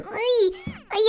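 normal talking voice

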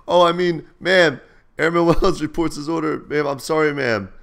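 high, loud, hasty trainee correction; flustered apology. Retold or imitated. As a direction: blurt a panicked recruit's apology. Flustered trainee corrects himself to instructor.

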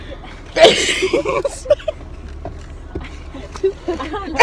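squealing giggle, breathy; high squealing laugh. Real, not acted. Laughing at people's groceries.

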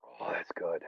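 hushed, breathy aside about the food; whisper. Real, not acted. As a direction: whisper it to yourself, savoring.

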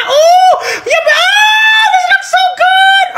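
high wailing cry over the food, hammed-up; mock crying ecstasy. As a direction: overcome with fake tearful delight.

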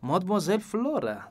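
the dapper detective, pleased with his plan; Poirot voice. A narrator's reading. Poirot addresses Flora.

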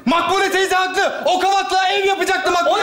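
hammed-up shouting at the family; mock shout. Shouting during the argument.

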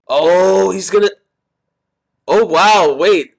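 real excited reaction; excited realization. Real, not acted. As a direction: react as the twist dawns on you. Reacting to a plot turn.

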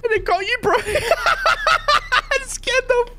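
giggling high through the words; laughing while apologizing. Real, not acted. Laughing at friend's fall.

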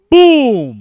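loud, high shouted boom; mock gunshot boom. Retelling the fatal shot with a shouted boom. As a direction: blast it out like the gunshot.

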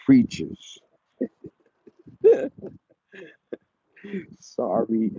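ordinary delivery

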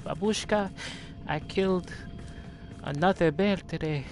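put-on high little kid with a Russian accent; squeaky child calling out to grandma, Russian accent. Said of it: "some young four-year-old child".

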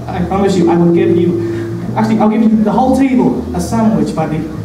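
ordinary delivery

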